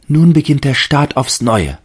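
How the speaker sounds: hohe, lautere, gläubige Baumstimme; Stimme des Baumes. A narrator's reading. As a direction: als staunender Tannenbaum. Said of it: "dachte der Baum". (hohe, überzeugte Baumstimme)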